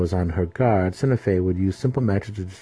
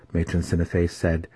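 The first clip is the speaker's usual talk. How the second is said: usual speaking voice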